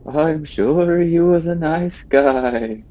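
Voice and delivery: high, very slow moaning reply; zombie moan. Acted. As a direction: moan it out dryly. Player answers in zombie voice.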